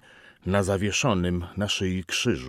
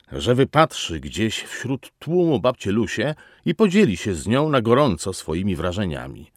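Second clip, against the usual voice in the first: ordinary delivery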